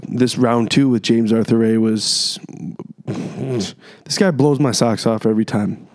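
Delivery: ordinary talk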